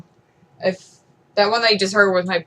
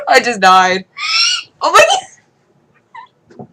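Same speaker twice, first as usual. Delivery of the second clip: loud, laughing through it; laughing shout; 11 semitones higher, 8 dB louder than usual. Real, not acted. Vlogger laughing at her own terrible cat sound.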